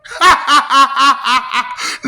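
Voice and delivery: loud staccato cackle; mocking cackle. Laughing at a crybaby, mocking cackle. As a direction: burst into loud, pulsing taunting laughter.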